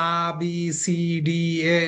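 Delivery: stretched letter names as the wrong way to read; drawn-out letters. Shown as a demonstration. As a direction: drag "আ, বি, সি, ডি, এ" into one slow, falling run. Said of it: "আপনি যদি এভাবে পড়েন".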